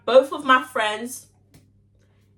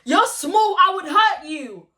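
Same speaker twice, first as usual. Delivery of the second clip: higher, louder taunt, slow at the end; imitating the girl; 7 semitones higher, 4 dB louder, slower than usual. Girl taunting her size, mock cocky voice. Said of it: "She's like".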